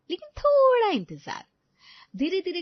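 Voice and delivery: high, loud sing-song tease; teasing suspense. A narrator's reading. Narrator teasing listeners to wait, playful high tease.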